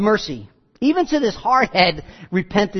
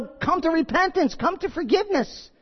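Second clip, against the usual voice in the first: high, softer drawn call; an imploring invitation pitched well up; 8 semitones higher, 4 dB quieter than usual. Retold or imitated. God calling Cain to repentance, high pleading call.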